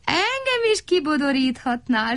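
high, longing crow voice; dreamy high-pitched plea; sighing. A narrator's reading. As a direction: sigh it high and wistfully. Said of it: "sóhajtott szirénfalvi szürke varjú Szeréna".

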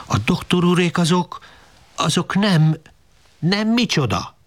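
trying to calm his wife, losing his nerve; hesitant pleading. Acted. Pista tries to placate Rozi, high and faltering. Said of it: "próbálkozott Pista bácsi".

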